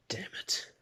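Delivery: whispered, barely audible "Damn it"; defeated whisper. Acted. Classmates losing out on the points, whispered grumble. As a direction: mutter it under your breath in defeat.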